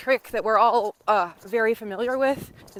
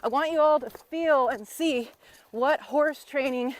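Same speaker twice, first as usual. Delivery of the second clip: usual speaking voice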